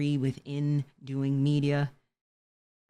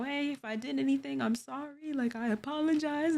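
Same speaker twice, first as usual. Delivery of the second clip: mock apology; 8 semitones higher than usual. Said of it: "or just like".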